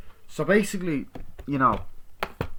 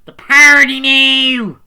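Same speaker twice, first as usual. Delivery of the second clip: squeaky mock; 21 dB louder, slower than usual. Real, not acted. Mocking the Scary Movie title.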